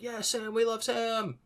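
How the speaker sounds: a group's giddy high-pitched cheer; mock cheering. Everyone in the theater cheering when Sam appears.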